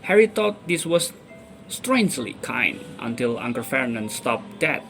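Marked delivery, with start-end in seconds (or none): none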